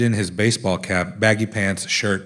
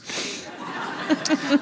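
airy giggle, barely voiced; breathy high laugh; 5 dB quieter than usual. Real, not acted. Laughing at friend's tight shorts, breathy high giggle.

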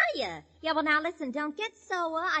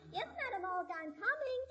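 high, soft singsong reply; answering the boss; 4 semitones higher, 10 dB quieter than usual. Pretend. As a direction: call back sweetly high and softly. Calls back to her boss.

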